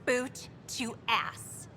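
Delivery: slow, deliberate word-by-word delivery; savoring relish. Acted. Worker telling how the boss kicked Spender out, relishing it. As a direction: draw out each word with relish.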